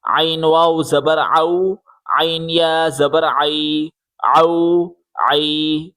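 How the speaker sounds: tight throat squeeze starting "عین", "عو", "عی"; pressed in throat. Shown as a demonstration. Teacher modelling the letter for pupils, throat-pressed ain. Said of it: "گلے سے زور لگا کے پڑھیں".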